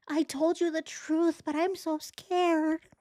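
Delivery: high, fake-crying whine imitating Chris; mock whiny victim. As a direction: whimper it like a self-pitying victim. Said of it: "He's victimizing himself again".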